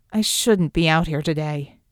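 low-voiced, weak protest. A narrator's reading. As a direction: protest feebly, pitch sinking. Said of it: "drowned out Klaus's weak protest".

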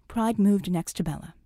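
usual speaking voice

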